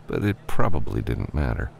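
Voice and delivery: usual speaking voice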